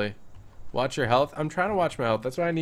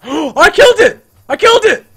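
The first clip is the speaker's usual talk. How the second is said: gasp, then much higher, louder victory cry; triumphant shout; 16 dB louder than usual. Real, not acted. Celebrating the lizard kill, triumphant high shout. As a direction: scream the win.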